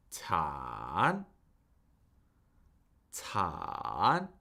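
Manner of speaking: third-tone "Tan" with a long low dip and upturn; falling-rising third tone. Shown as a demonstration. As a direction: draw "Tan" down, then swing it up. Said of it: "it falls and then it rises".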